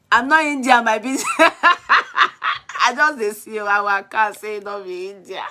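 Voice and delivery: talking through laughter; real laughing. Real, not acted. Teasing a viewer mistaken for Indian, laughing. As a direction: break up laughing mid-sentence.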